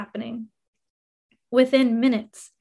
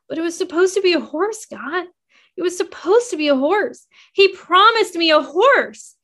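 put-on a girl's hope collapsing, pleading louder; rising anguish; 6 semitones higher, 4 dB louder than usual. A narrator's reading. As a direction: voice climbing higher and louder into despair. Narrator reliving crushed hope.

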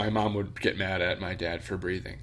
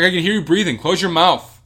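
louder, higher annoyed order; irritated mom; 9 semitones higher, 10 dB louder than usual. Retold or imitated. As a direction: snap at him to close it. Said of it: "She's like".

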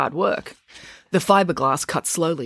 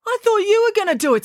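high, aggrieved protest; high-pitched defensive retort; 4 dB louder than usual. A narrator's reading. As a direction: push the blame back in a high voice. Accused over the uncharged battery.

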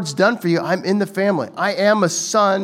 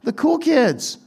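higher, fast eager host inviting you up; host calling you up; 8 semitones higher than usual. Retold or imitated. Host calls a guest to the head table, eager and higher. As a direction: call them up to the table like a friendly host. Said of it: "better to be called up".

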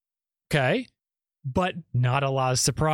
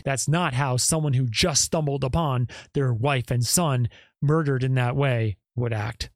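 normal talking voice

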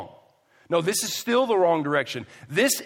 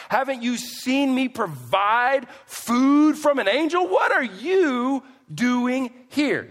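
high, loud, mounting incredulity with a drawn-out YOU; sarcastic ridicule; 11 semitones higher, 7 dB louder than usual. Acted. Preacher as God berating runaway Elijah, sarcastic ridicule. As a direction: berate him in disbelief, building to a slow final question. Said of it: "spiritual gift of sarcasm and ridicule".

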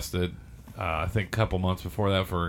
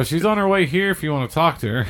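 higher, louder confident bluff; cocky bluff; 7 semitones higher, 6 dB louder than usual. Retold or imitated. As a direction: bluff the cop with bravado. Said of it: "I go".

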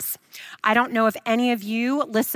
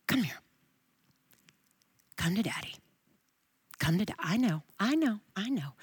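soft, lower, hushed, dropping to a whisper; tender fatherly comfort; 3 semitones lower, 8 dB quieter than usual. Shown as a demonstration. Preacher voicing God comforting a scared child, tender and hushed. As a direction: coax a frightened child close, gently. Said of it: "That's how I hear that".

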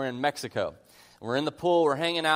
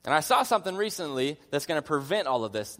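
ordinary talk

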